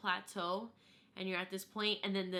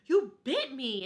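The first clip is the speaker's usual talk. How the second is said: loud shocked yelp; startled husband; 4 semitones higher, 10 dB louder than usual. Retold or imitated. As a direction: jump back and blurt it out. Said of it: "and he's like".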